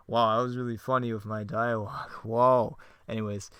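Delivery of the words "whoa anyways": ordinary delivery, nothing marked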